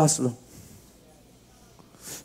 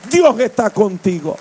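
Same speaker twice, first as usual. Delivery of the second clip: much higher and louder, preaching at full force; shouted preaching; 8 semitones higher, 4 dB louder, slower than usual. Acted. Pastor shouts the climax of his testimony to the church. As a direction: lift the church with a fervent cry.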